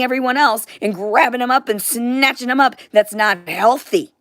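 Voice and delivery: venting fury at people chasing money; angry rant. Real, not acted.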